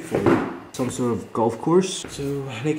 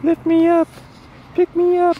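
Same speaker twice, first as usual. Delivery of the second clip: very high, loud squeaky dog voice; dog's pleading voice; 5 dB louder than usual. Pretend. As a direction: beg to be picked up like a tiny dog. Voicing the dog's wish.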